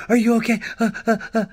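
high, loud imitation of frantic concern; mock panic. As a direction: fuss in an exaggerated panic, ending in frantic gasps. Mocks today's overanxious fussing over trauma.

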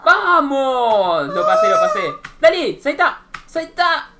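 loud, high victory yells; real excited gamer hollering; jubilant shouting. Real, not acted. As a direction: cheer each jump at full voice. Celebrates passing the obstacle.

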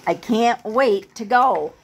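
usual speaking voice

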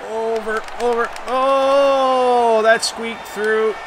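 high urgent cries; urgent excitement. Real, not acted. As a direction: lean into the near goal. Near-goal in overtime.

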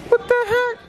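very high disbelieving squeal; shocked squeal. Real, not acted. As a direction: shriek it in disbelief. Shocked at crowd.

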